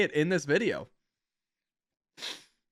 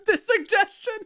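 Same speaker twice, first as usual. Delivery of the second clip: laughing. Real, not acted. Streamer cracking up at joke.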